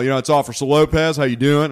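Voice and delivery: a light mock of a cop forcing a tough voice; imitating the cop. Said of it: "he talks like this".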